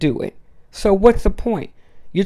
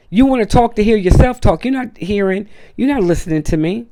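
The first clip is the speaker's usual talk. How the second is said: ordinary delivery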